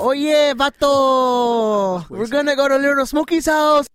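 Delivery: hammed-up Chicano accent, excited. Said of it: "Bit racist, that one".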